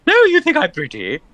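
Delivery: high, delighted squeak; high flattered squeak. Real, not acted. Player flattered reaction.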